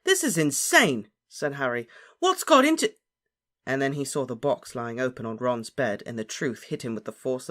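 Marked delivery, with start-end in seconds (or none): 0.0-1.1 loud, slow, high exclamation; exasperated. A narrator's reading. Harry reacts to Ron's attack, exasperated. Quarrel after being hit. 2.2-2.9 very high, loud outburst; exasperated cry. A narrator's reading. Harry demands explanation from Ron, exasperated cry. Quarrel, cut off.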